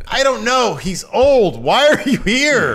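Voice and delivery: high, loud mock outburst; hammed-up impatience, voice leaping up; exasperated. Picard busy while Crusher yaps away, exasperated.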